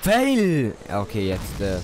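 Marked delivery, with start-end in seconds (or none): 0.0-0.8 loud. Real, not acted. Dies in the game.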